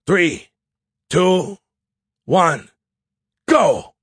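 high, punchy count building to a shout; shouted countdown. Acted. Officer starting squad's dash from sniper, urgent shout. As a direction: call each number out as an order.

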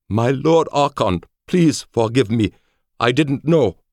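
high, loud, anguished apology; pleading guard. A narrator's reading. Samurai kneels before Felix for forgiveness, high pleading. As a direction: beg in a high, urgent voice.

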